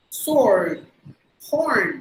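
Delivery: single words with a curled American R; American R accent. Shown as a demonstration. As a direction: stretch each American R, falling tone. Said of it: "So that's how they would say". (அமெரிக்க உச்சரிப்பு)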